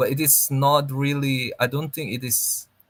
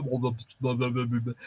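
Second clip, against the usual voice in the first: put-on Filipino accent; 5 dB quieter, faster than usual. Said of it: "That's more Filipino".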